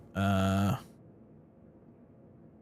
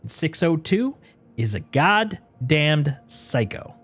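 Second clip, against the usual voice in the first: emphatic indignant employee venting; dramatic note reading; 4 dB louder than usual. Acted. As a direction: read the complaint out as an outraged staffer. Reads a game note in character.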